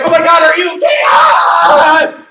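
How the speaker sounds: very high, loud panicked shriek; what not to do, hammed-up panic; mock panic. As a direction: overreact like a frightened parent. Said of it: "you're not gonna be like".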